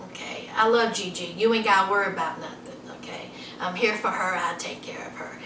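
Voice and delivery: soft, low, fast whispered promise; fading into a whisper; hushed imitation. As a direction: whisper the promise, with Damien soothing her family. Voicing Damien reassuring her family.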